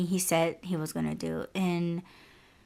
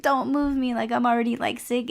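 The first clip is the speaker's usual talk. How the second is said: higher, whiny plea not to be moved; pleading sick voice; 5 semitones higher, faster than usual. Retold or imitated. To her dad while throwing up, a higher whiny plea. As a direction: beg him not to move you, feeling sick. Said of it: "but I was just like".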